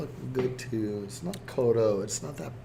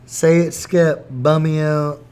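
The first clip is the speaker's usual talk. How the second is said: loud teasing; 3 semitones higher, 10 dB louder, slower than usual. Real, not acted. Teasing friend to repeat.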